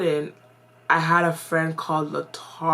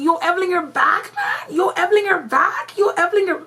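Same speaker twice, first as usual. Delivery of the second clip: put-on much higher, excited kids calling out Yo Evelyn; kids hyped greeting; 13 semitones higher, 3 dB louder than usual. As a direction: mimic church kids thrilled to see her back. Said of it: "the kids see me".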